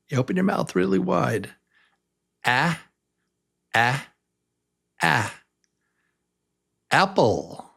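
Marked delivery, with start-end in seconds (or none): none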